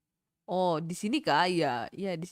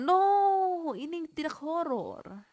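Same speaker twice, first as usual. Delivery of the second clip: character voice; 9 semitones higher, 4 dB louder, slower than usual. Pretend. Mock game character protest.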